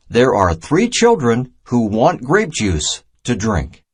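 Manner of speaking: ordinary delivery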